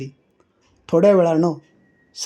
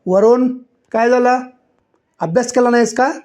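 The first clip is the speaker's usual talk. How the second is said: put-on high, raised voice calling the boy out; teacher shouting; 6 semitones higher than usual. A narrator's reading. Teacher to silent pupil, raised scolding call. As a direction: demand an answer from the pupil. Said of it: "ते ओरडले".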